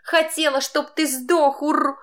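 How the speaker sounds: high, slow furious sobbing cry; angry crying. A narrator's reading. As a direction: scream it through tears. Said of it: "захлёбываясь слезами".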